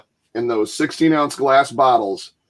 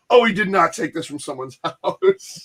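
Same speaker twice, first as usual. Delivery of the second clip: laughing disbelief; 5 semitones higher than usual. Retold or imitated. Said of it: "And I'm like".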